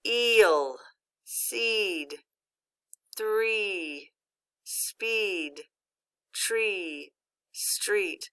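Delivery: each word read out slowly and clearly, like a phonics card; clear word list. Shown as a demonstration. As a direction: model every long E word for the class. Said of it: "let's go ahead and see what that looks like".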